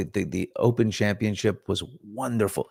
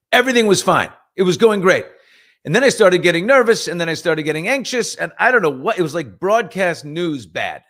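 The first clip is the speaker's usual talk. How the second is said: higher and louder, building nerves; frantic, higher retelling; rising panic; 9 semitones higher, 8 dB louder than usual. Retold or imitated. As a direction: tell it as the anxiety spirals.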